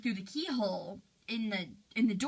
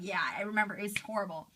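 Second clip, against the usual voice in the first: ordinary delivery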